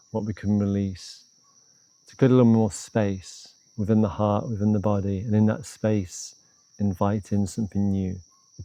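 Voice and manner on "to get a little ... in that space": usual speaking voice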